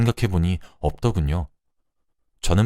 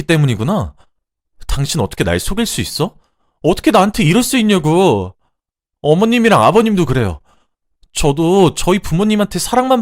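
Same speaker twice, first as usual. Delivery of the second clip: high, loud outraged accusations; furious outburst; 9 semitones higher, 6 dB louder than usual. Acted. Wife accusing husband and in-laws of marriage fraud, furious outburst. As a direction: rage at them, climbing to a high shout.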